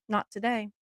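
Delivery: quietly teasing her soaked husband; soft smug retort. Retold or imitated. Soft retort after prank.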